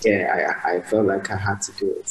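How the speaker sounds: usual speaking voice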